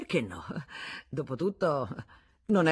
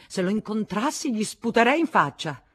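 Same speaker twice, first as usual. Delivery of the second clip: loud, higher-pitched scornful threat; spiteful anger; 5 semitones higher, 7 dB louder than usual. A narrator's reading. Woman vowing revenge on her lover, angrily. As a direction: spit the words out with loathing.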